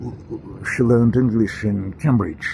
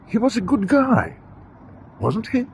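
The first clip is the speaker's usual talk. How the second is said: mock-naive question pitched much higher; ironic question; 11 semitones higher than usual. Speaker to audience, mocking the good-guy view of Gorbachev, ironic high question. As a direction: set it up like a naive fan before knocking it down.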